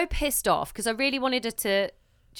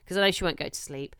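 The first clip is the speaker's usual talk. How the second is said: ordinary talk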